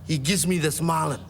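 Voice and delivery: usual speaking voice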